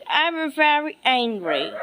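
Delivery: normal talking voice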